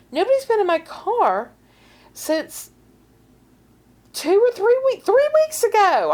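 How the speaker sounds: high, insistent imitated denial; emphatic. Worried friend answering who was in her car, emphatic. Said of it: "she's emphatic".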